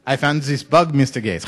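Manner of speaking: hammed-up French accent; mock-French engineer. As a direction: play a comic Frenchman addressing a big boss. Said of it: "do this in a French accent".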